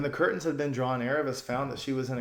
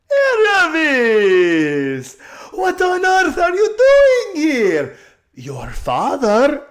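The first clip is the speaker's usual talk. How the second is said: loud, high, drawn-out shout across the street; top of her voice; 14 dB louder, much slower than usual. A narrator's reading. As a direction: call out in delighted surprise. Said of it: "burst out at the top of her voice".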